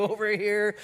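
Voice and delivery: ordinary talk, nothing marked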